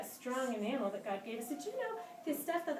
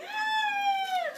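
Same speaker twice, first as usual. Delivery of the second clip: shrieking tooth, hammed up; high mock scream; 7 dB louder than usual. Voicing the tooth for the kids, high mock shriek. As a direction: squeal way up high like a scared tooth.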